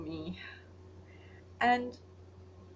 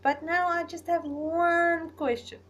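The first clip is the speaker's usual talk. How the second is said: playful mock question; 8 semitones higher, 8 dB louder, slower than usual. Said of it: "and I'm like".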